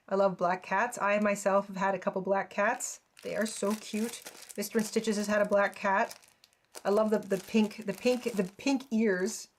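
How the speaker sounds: ordinary delivery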